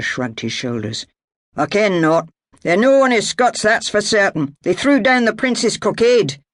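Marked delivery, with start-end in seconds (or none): 1.6-6.4 louder, gruff-toned Scots fighter giving orders; armed Highlander ordering his prisoners about; Highland clansman. Acted. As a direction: bark it like a Jacobite soldier. Alexander's Highland voice.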